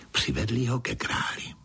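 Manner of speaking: ordinary delivery, nothing marked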